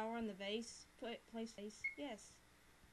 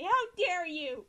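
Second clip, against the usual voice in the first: much louder, slow indignant cry; hammed-up outrage; 7 semitones higher, 14 dB louder, much slower than usual. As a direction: drag it out in fake fury. Hungary line, hammed up.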